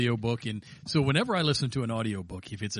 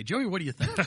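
mock host question; 9 semitones higher than usual. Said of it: "waiting for a point where I say".

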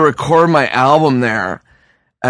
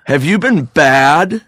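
slow, teasing, a bit louder; slow suggestive question; 3 semitones higher, 3 dB louder, slower than usual. Retold or imitated. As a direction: purr it like a kinky master. Throne man asks suggestively.

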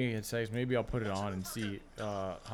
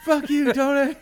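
much higher, louder mock insult through laughter; quoting a clip; 14 semitones higher, 12 dB louder than usual. Friend imitating a TikTok clip, high loud laughing insult. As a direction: spit it out goofy and laughing. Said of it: "just like that other one".

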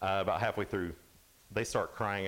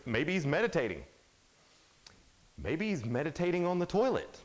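taunting, pitch swooping up high; mocking; 5 semitones higher than usual. Elijah taunting the prophets about their absent god, mocking. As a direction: float a mock suggestion, rising at the end. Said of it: "here Elijah's mocking them".

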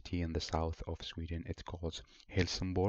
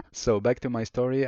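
higher and louder, snapping back on track; loud restart; 6 semitones higher, 8 dB louder than usual. Real, not acted. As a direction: call yourself back to the story, loud and high. Loudly resets after a digression.

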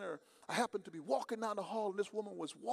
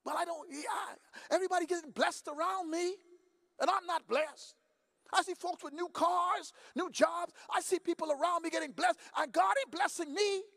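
bitter complaint; 6 semitones higher than usual. Retold or imitated. Woman complaining to the preacher that others are blessed, aggrieved. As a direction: protest being left out, rising to a shout.

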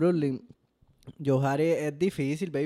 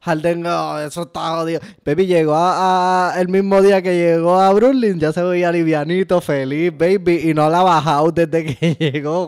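loud, pitched-up hype about Harden; excited shouting; 2 semitones higher, 9 dB louder than usual. Real, not acted. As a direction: yell it out, carried away by excitement. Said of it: "Estoy gritando". (gritando emocionado)

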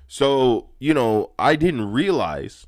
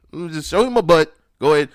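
cheeky offer; 7 semitones higher, 6 dB louder, faster than usual. Retold or imitated. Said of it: "I was like".

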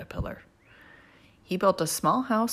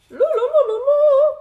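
loud, very high fluting "loo-loo"; high flamingo toot; 14 dB louder than usual. Acted. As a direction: imitate a flamingo's fluting call at high pitch. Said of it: "I hear a flamingo fluting".

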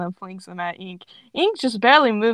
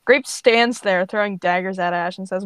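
usual speaking voice

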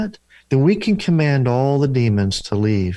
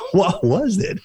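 mock bewildered, pitched up; puzzled, higher question; 8 semitones higher than usual. Retelling his baffled reply to the client, pitched up. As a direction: play baffled at nothing happening. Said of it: "I'm like".

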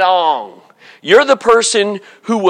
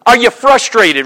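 ordinary talk, nothing marked

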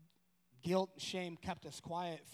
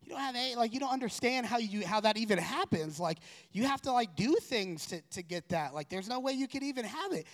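higher and louder, a mom rushing to reassure; imitating a mother talking her panicking son down; mother insisting, higher and louder; frantic mom; 6 semitones higher, 6 dB louder than usual.

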